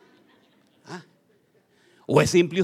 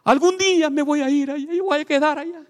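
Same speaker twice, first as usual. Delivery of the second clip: high, loud mocking voice of a homesick local; mock homesick boast; 12 semitones higher, 6 dB louder than usual. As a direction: brag about the hometown in a put-on voice. Mocks homesick local pride.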